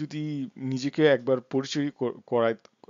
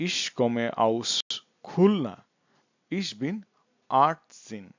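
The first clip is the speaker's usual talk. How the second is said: slow German with gaps between phrases; slow model introduction; much slower than usual. Shown as a demonstration. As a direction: introduce yourself slowly for learners. Teacher models second introduction. (ধীরে থেমে থেমে পরিচয়)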